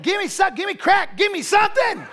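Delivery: high, loud pleading yell; wife in labor begging for drugs, high loud yell; desperate yelling. Retold or imitated. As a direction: beg at the top of your voice. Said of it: "She's like".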